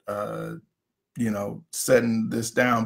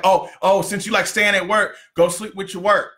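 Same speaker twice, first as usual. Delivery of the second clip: high-pitched, hammed-up mock nagging; worked-up wife; 7 semitones higher than usual. Husband mimics wife jealous of his work, worked up. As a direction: mimic a petty, fed-up wife in a raised voice. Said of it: "she's all emotionally worked up".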